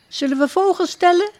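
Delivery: high, quick playful question; bubbling with an idea; eager suggestion. Acted. Princess suggesting bird counting to the duck, high and quick.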